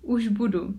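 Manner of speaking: ordinary talk, nothing marked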